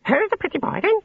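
very high squawky parrot shriek; shrieking budgerigar. A narrator's reading. Budgerigars waking in the trees, shrieking. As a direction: shriek it like a pet budgie.

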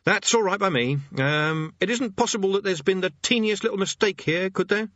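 high-pitched, anxious appeasing; placating Rincewind. Acted. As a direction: tentatively talk your way out, pitched high.

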